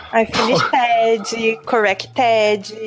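drawn-out "finished" and "corrected", each ending in a full "-ED-jee"; mock a posh Brazilian girl overpronouncing -ed in "finished, corrected"; slow Brazilian English, extra vowel closing "finished" and "corrected"; Brazilian patricinha. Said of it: "patricinha também".

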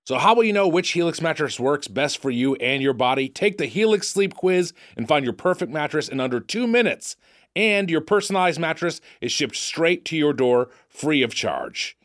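upbeat sponsor read. Acted. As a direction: sell the mattress cheerfully. Reads sponsor copy.

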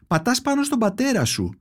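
a mild push, the mother insisting. Acted. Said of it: "πιο δυνατά αυτή τη φορά". (επίμονα, πιο έντονα)

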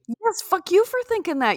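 high-pitched playful scolding; mock-angry comeback. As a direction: fire the insult back in play. Insists in comic quarrel.

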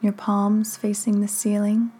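unhurried meditation guide, long pauses between phrases; measured, calming delivery with room to breathe; guided meditation. Acted. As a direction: guide the listener gently into stillness, phrase by phrase.

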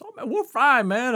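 breezy oblivious. Retold or imitated. His oblivious reassurance.

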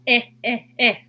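"え" repeated as the short e in egg; phonics short e. Shown as a demonstration. Teacher demonstrating the phonics sound え to listeners. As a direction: give the sound "え" three clear times. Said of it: "Eのフォニックスの音はえ".

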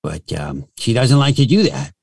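put-on voice of a randy, disgruntled dog; perverted corgi voice. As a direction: talk as a pissed-off, pervy little corgi. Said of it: "so the corgi sounds like this".

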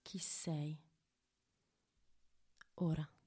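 hushed, intimate whisper. Acted. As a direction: breathe the question softly. Whispered meditation question.

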